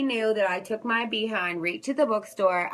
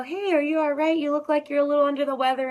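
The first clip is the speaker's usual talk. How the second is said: concerned friend; 6 semitones higher, 5 dB louder, faster than usual. Retold or imitated. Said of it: "or they'll say".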